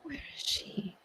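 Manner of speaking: whispered to herself; whispered aside. Real, not acted. Looking for the stream, whispered to herself. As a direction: whisper it while searching.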